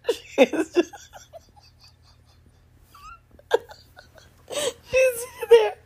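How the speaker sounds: high-pitched, wheezing through tears of laughter; laughing so hard it turns to crying. Real, not acted. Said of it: "I'm sitting here crying".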